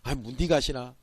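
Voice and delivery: Gyeongsang dialect. Retold or imitated. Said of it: "애칭처럼 쓰거든요".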